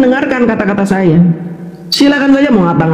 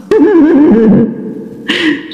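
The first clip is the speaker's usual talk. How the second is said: real, drawn-out chuckle; amused laugh; 4 semitones higher than usual. Real, not acted. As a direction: laugh at your rattled opponent. Menertawakan lawan yang panas. (tertawa mengejek)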